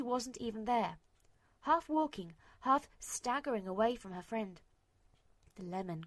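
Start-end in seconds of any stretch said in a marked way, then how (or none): none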